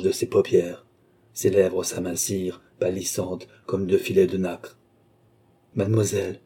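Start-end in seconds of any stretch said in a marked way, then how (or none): none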